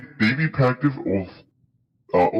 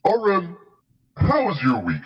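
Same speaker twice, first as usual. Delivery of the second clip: talking through a mouthful; chewing while speaking; mouth full; 9 semitones higher than usual. Real, not acted. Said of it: "got some chew in my mouth".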